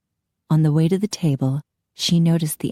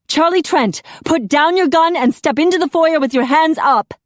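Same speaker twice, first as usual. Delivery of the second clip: loud, high shouted order; officer shouting orders at an armed man; police command; 11 semitones higher, 5 dB louder than usual. A narrator's reading. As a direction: command him to surrender.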